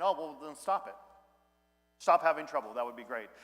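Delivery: lightly voiced offhand counselor; dismissive counselor. Pastor mocking a counselor who waves problems away. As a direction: brush it off like a counselor who doesn't care. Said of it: "and the counselor just saying".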